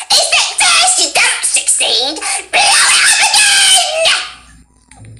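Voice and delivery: crazed high screech, last words stretched out; unhinged cackling shriek, all bolts loose; insane Junkrat. Shown as a demonstration. Showing the guest how the insane bomber sounds, manic shriek. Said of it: "his line goes like this".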